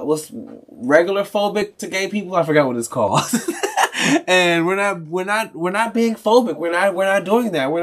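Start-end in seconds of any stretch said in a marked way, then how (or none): 3.3-4.2 a sudden high airy laugh, caught off guard; burst of laughter. Real, not acted. Streamer laughing at himself after blanking on a word. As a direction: laugh it off at yourself, breathy and high. Laughs at his own forgetting.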